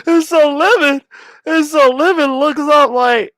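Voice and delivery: high voice squeezed by laughter; squeaky, laugh-choked restarts; cracking up. Real, not acted. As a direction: struggle to get the line out while laughing. Restarting story through his laughter.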